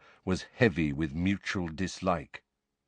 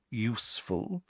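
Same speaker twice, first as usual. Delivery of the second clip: "useful" softly drawn out, much softer; delicate stress; 2 semitones higher, 9 dB quieter than usual. A narrator's reading. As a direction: lean quietly on "useful" to wound. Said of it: "Snape laid a delicate stress on the word".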